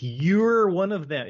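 "You're" stretched slow, high and loud with a wide-open vowel; open-mouthed "you". Retold or imitated. Quoting Rickman's performance, exaggerated open-mouthed "You're". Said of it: "it's a very, uh, open-mouthed".

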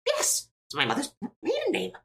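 proud squeaky answer; Kick voice. Acted.

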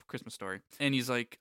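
normal talking voice

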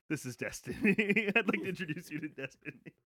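laughing through quote. Retold or imitated. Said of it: "a small man ... says".

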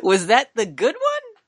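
high, slow mock question; teasing. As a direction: tease him in a high voice.

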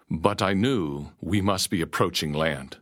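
plain reading voice

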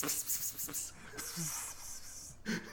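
whispered psst psst to a cat; cat-calling psst. Retold or imitated. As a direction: hiss pss pss like calling a kitty. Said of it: "call him back like a cat".

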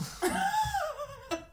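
high, soft laugh; a soft, squeaky giggle. Real, not acted. Laughing at her own London mix-up, high and soft.

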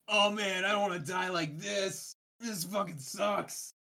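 whiny doomed groan; Chad's voice. Acted. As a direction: complain like you're about to die. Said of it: "you recognize one voice".

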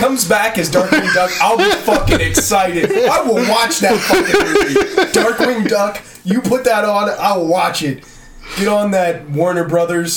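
loud, high gushing excitement; excited. Real, not acted. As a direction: get giddy and loud. Excited about Darkwing Duck.

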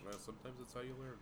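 quiet, fast put-on voice; mock sage. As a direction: echo it in a hushed put-on voice. Echoed in another voice.

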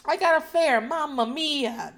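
put-on loud, high hammy Italian accent; Italian accent, drawn out at the end; Antonio Scarpacci's Italian accent. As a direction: play a cartoon Italian cabbie. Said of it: "that's the fucking accent he would use".

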